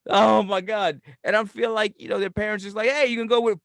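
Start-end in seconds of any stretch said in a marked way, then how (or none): 2.9-3.6 quick, louder, higher send-off; breezy mock parent. Parents sending their kid off for the summer, breezy dismissal. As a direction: palm the kid off in a hurry.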